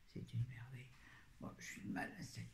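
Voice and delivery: soft whisper, trailing away; an unvoiced whisper of praise, then a muttered aside; hushed whisper. Real, not acted. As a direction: breathe it out like a secret.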